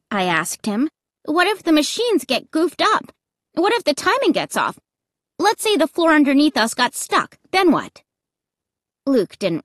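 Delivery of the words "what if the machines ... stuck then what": high, insistent questions piling up; anxious arguing, raised pitch; worried challenge. A narrator's reading. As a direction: press him with each what-if.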